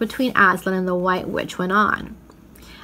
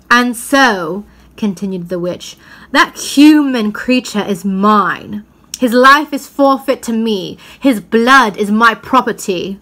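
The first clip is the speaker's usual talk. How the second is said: louder, slow, menacing claim; possessive witch; 6 dB louder, slower than usual. Acted. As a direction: lay claim to him slowly and coldly. Witch claims Edmund's life.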